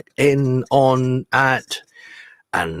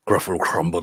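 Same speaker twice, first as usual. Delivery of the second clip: lightly voiced, low-pitched Gruffalo echo; daunted Gruffalo; 6 semitones lower, 3 dB quieter, faster than usual. Gruffalo echoing the mouse's threat, mildly daunted. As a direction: repeat it lower, a touch daunted.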